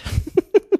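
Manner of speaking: a short, very high burst of laughter; a quick squeaky laugh of delight. Real, not acted. Laughs while reading the book.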